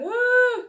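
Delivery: retold cries of labour pain, high and loud; pained moaning. Woman in the bath mid-contraction, high pained moans. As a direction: groan it out like a contraction just hit. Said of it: "I was just like".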